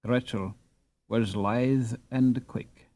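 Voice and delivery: plain reading voice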